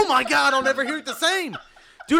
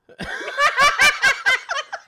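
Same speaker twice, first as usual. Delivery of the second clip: shrieking giggle, real; high helpless laugh; 4 dB louder than usual. Real, not acted. Cracking up again.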